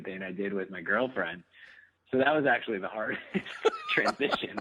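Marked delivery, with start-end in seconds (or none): 3.3-4.6 laughing. Real, not acted. Laughs mid-sentence.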